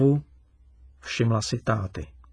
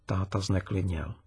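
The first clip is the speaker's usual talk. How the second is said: ordinary delivery, nothing marked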